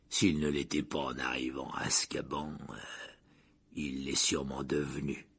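very slow voice. A narrator's reading. Driver remarking on the prisoner's madness, very slow. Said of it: "dit Ern d'une voix très lente".

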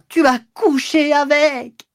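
slow, very high stretched scream; vociferating. A narrator's reading. Fallen mistress turning back on him, vociferating the accusation. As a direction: scream it out, dragging each word. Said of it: "vociféra encore une fois".

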